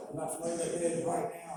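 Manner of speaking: lightly voiced cop command; police order. Voices cops ordering him out.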